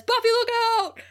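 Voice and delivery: high urgent yell of a warning; warning shout. Retold or imitated. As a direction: call out sharply to warn her.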